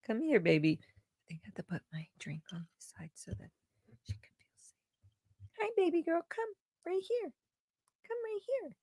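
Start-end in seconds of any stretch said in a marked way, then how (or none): 0.8-4.8 hushed whisper aside; quiet whispered aside. Real, not acted. Not scaring the cat. 5.6-8.8 much higher, soft coaxing pet voice; sweet high voice to lure the cat; coaxing the kitty in high tones; cat-calling voice. Real, not acted.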